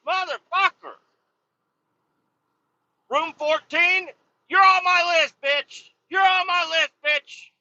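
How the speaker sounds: high-pitched, hammed-up threat; angry shouting. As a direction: yell at the door-slamming neighbor. Acted threat to neighbor in room fourteen.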